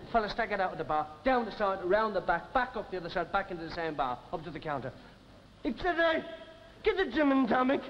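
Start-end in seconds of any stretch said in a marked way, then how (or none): 5.6-7.8 hammed-up staggering drunk; town drunk. Drunk returns a third time.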